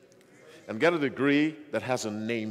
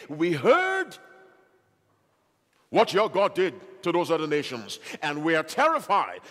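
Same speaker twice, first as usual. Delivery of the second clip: Rahab's awed cry; 4 semitones higher, 5 dB louder than usual. Retold or imitated. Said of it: "Rahab was virtually saying".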